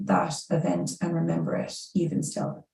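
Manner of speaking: ordinary delivery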